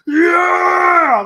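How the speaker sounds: high, loud whoop of a fan; mock stadium cheer; cheering shout. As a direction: yell it like a crazed home fan. Retold fan cheering with pom-pom.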